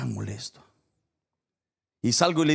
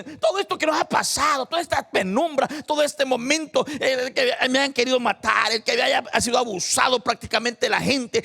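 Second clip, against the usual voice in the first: high, loud preaching shout; José shouting comfort to his brothers; preaching at full force; 13 semitones higher, 6 dB louder than usual. Acted. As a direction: proclaim it to the whole church.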